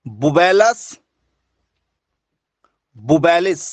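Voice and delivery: बुबेलस बुबेलिस said slowly with long pauses between; the teacher dictating बुबेलस बुबेलिस word by word to students; careful dictation. Shown as a demonstration. बुबेलस बुबेलिस spoken slowly, word by word. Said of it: "इसको कहेंगे".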